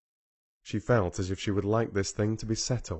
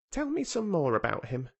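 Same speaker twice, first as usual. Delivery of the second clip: lightly voiced boy; 8 semitones higher than usual. A narrator's reading. Colin voiced by narrator.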